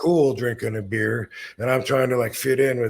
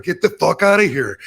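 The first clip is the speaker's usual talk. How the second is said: retold loud urging; 6 semitones higher, 7 dB louder than usual. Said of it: "they totally were like".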